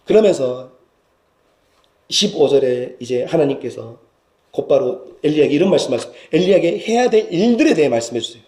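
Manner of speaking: ordinary talk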